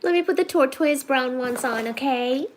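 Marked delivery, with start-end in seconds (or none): none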